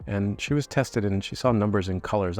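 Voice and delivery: ordinary delivery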